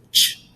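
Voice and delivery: "ch" as a bare consonant sound, no vowel; crisp standalone "ch", not letter names; isolated ending sound. Shown as a demonstration. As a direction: sound out "ch" as one short burst, like the end of catch. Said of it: "at the end of the syllable".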